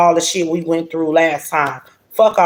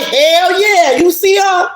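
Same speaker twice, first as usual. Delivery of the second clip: high-pitched, hyped-up lover's brag; excited boasting; 10 semitones higher, 4 dB louder, slower than usual. Acted. Reader voicing Emperor showing off his woman, excited boasting. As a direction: crow it high, showing her off.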